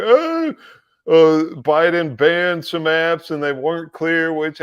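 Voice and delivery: high, whiny mocking excuse voice; mock whiny official. Mocking the officials' excuse in a high whiny voice. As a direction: sneer it like a lame excuse.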